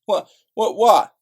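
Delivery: sharp, loud, high disbelieving bark; indignant yell; outraged shout. Real, not acted. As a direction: blurt it out in outrage. Outraged at racist remark.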